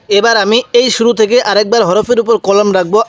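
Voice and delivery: ordinary talk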